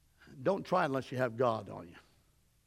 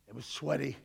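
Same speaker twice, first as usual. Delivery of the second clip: ordinary talk, nothing marked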